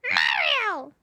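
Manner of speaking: high, loud gargled squawk; Toad. Shown as a demonstration. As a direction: cry out as if gargling spit. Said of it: "it's like you're gargling spit".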